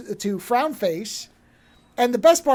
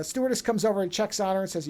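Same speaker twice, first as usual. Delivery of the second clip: ordinary talk, nothing marked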